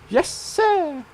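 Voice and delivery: put-on squeaky high weird accent on yes sir; "Yes, sir" jumped way up into a strange put-on accent. Voicing Elena answering Tseng in a weird high accent. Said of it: "in that sort of weird accent".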